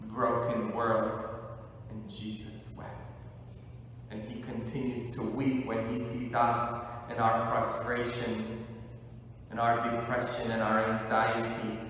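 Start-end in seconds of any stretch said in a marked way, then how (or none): none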